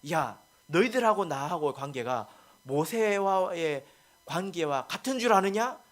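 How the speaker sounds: loud, high rebuke in an acted quote; preacher acting out an indignant dressing-down; angry God. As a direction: scold them as an angry God, pitch climbing on each question. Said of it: "하고 화를 내고 나가버리셨어요".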